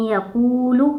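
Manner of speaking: यकूलू slow, with कू and लू stretched; teacher modelling the madd in यकूलू; lengthened madd. Shown as a demonstration. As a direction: recite यकूलू drawing out each long ू. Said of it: "यकूलू ऐसे पढ़ेंगे".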